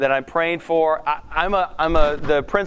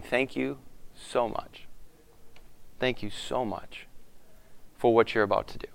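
soft prayer; 7 semitones lower, 10 dB quieter than usual. Shown as a demonstration. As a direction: hush it like a closing prayer. Demonstrates ending his prayer.

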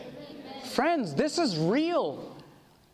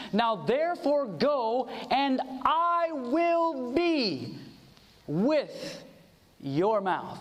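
very slow, drawn-out scripture promise; solemn declamation; much slower than usual. Acted. God sending a worker out to speak, slowly declaimed. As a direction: stretch each word out, pausing before the last ones.

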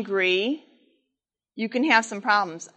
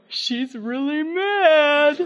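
sly aside; 4 semitones higher, much slower than usual. Retold or imitated. Dad whispering to kids.

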